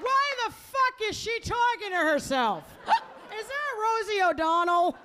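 high-pitched mock bewilderment; gawk aloud in exaggerated confusion. Audience member wondering at the comic, baffled.

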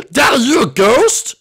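high, loud, drawn-out question; acted shock at seeing a ghost; astonished. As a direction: blurt it out in amazement. Son sees his dead father.

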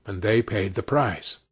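usual speaking voice

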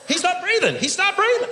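very high, alarmed cry; mock panic. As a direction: play a bystander panicking, pitch way up. Acted alarm over man not breathing.

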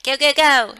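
loud, high cheer of go; excited cheering. Retold or imitated. Wife and husband cheering each other on, loud excited cheer. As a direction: hype each other up with a shout. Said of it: "We're like".

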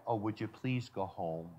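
soft, mock-polite little request. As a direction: ask them nicely to leave, barely above a breath. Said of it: "It isn't like".

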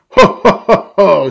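loud, high "ho ho" laugh; hearty storybook father's laugh; booming acted ho-ho-ho; Daddy Pig's chuckle. A narrator's reading. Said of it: "says Daddy Pig".

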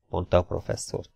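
usual speaking voice

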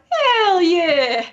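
loud, high "Hell yeah!"; catchphrase shout. Retold or imitated. Showing friends how they now say it, loud and high. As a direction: belt the catchphrase. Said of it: "now I started just going".

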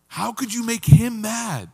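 high, loud, slow disbelief; acted-out outraged sibling; upset sibling. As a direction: scold in appalled disbelief. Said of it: "the people who were the most upset were the siblings".

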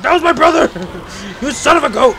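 high, loud outraged yell; mock fury over a dead brother; hammed-up enraged creature shouting; angry monster voice. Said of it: "He's like".

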